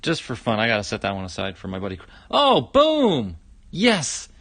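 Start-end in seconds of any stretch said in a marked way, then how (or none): 2.3-4.3 high, loud burst of triumph; excited cheer. Real, not acted. Card collector cheering a last pack find, excited. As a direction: whoop at a great pull.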